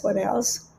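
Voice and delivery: usual speaking voice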